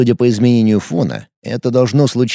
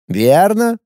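easy agreement; 6 semitones higher than usual. Acted. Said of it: "легко согласился Морн".

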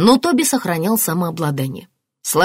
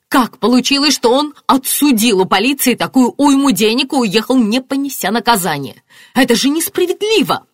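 high, loud, outraged protest; indignant; 8 semitones higher, 5 dB louder than usual. A narrator's reading. Marianna protesting the suspect's payout, indignant. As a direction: protest the injustice, climbing at the end. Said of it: "возмутилась Марианна".

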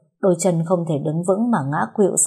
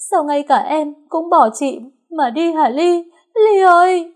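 wailing grief; 8 semitones higher, 5 dB louder than usual. A narrator's reading. Big sister crying out over her dead little sister, wailing grief. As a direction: wail and plead, voice climbing. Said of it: "ôm chầm lấy nó mà gào khóc".

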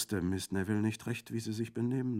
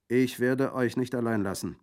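louder, higher steady promise; loud firm vow; 6 semitones higher, 9 dB louder than usual. Acted. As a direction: declare it boldly. Bandit emerging, firm.

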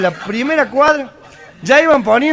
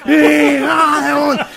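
grito nasal y alargado; multitud de gangosos; 3 semitones higher, slower than usual. Acted. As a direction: corea lento, imitando a los gangosos. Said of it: "todos como cinco mil gangosos". (ovación gangosa arrastrada)

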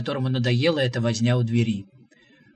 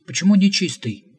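louder, higher hurt "Почему нечистый?"; indignant, stung by the insult; offended question; 5 semitones higher, 6 dB louder than usual. A narrator's reading. Visitor offended at being called unclean, indignant. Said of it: "и обиженно спросил".

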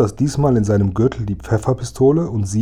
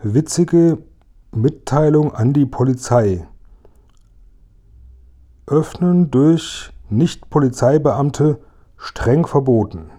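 slow, higher, deciphering "Öffnen durch Nicht-Polizeibeamte streng verboten" word by word; slow spelling-out read; 4 semitones higher, slower than usual. Acted. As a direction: read the label haltingly. Räuber entziffert die Aufschrift. (langsam und höher, Wort für Wort entziffert)

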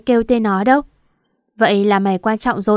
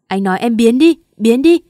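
loud, angry yell of a husband throwing her out; furious shout; 6 dB louder than usual. Acted. Husband drives his wife away, shouting furiously. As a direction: roar it like an angry tiger. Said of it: "Hoàng hét lên như một con hổ đang giận dữ".

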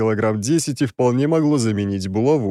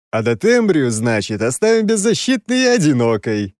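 louder, with nasty relish; gloating relish; 4 semitones higher, 4 dB louder than usual. Acted. Oleg lectures the prince with nasty relish, gloating. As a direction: savor it with malicious pleasure. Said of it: "с каким-то нехорошим удовольствием".

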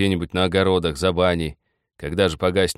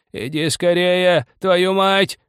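high, loud, drawn-out screech; angry shrill yell; 12 semitones higher, 6 dB louder, much slower than usual. A narrator's reading. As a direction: shriek spitefully, stretching the words. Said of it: "злобно-визгливо кричал старик".

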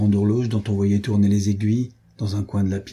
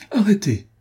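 sharp cry, much higher and louder; 10 semitones higher, 4 dB louder than usual. A narrator's reading. Adrienne seizing Jean de Werth's arm, a high loud cry. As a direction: shout to stop him at the door. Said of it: "s'écria mademoiselle de Souvigny".

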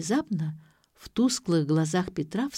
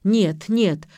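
loud, insistent refusal; raised-voice repeated denial; agitated denial; 2 semitones higher, 7 dB louder than usual. A narrator's reading. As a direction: blurt it out, rattled. Father refusing, shaking his head.

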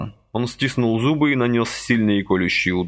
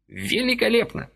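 mild cry of delight; delighted old man. A narrator's reading. Old man praises Vasily's blow, high delighted cry. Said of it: "вскричал дедок".

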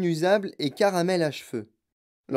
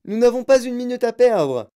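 loud, higher urgent call; hurried cry; 7 semitones higher, 7 dB louder than usual. A narrator's reading. Wonka rushing the visitors on to the next room. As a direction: hurry everyone along.

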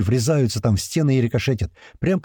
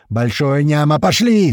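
raised voice building to a shout; yelled order; 4 semitones higher, 5 dB louder, slower than usual. A narrator's reading. Commander sending two gunners in, loud yell. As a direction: send the gunners forward loudly. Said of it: "снова орёт Туман".

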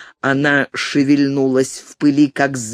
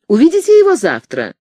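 ordinary delivery, nothing marked

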